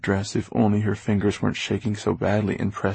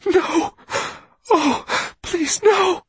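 very high, anguished gasped words; desperate breathy plea; 5 dB louder, much slower than usual. A narrator's reading. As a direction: plead in horror, voice leaping up high. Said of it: "whispered Risa".

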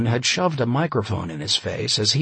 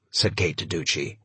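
usual speaking voice

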